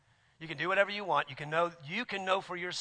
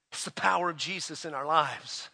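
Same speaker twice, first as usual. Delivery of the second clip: usual speaking voice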